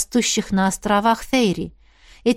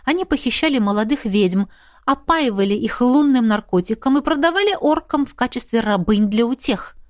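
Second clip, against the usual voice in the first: usual speaking voice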